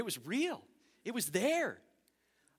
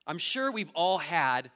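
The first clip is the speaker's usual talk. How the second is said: ordinary delivery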